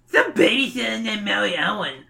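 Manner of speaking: put-on high, whiny little-kid voice; Stinko. A narrator's reading. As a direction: bleat it like a dopey little brother. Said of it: "Stinko replies".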